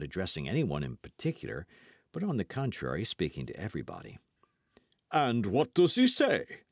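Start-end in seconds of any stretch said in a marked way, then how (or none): 5.1-6.6 smug, drawn-out question; pleased-with-himself Porthos, unhurried; self-sufficient. A narrator's reading. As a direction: ask it slowly, full of self-importance. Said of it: "asked Porthos in a self-sufficient tone".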